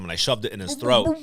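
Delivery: ordinary delivery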